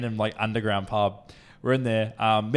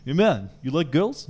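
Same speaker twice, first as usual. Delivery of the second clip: imitating a Russian guy, slightly higher; Russian accent; 4 semitones higher, 3 dB louder than usual. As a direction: put on a Russian accent for a bar stranger. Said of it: "some Russian guy comes up to me".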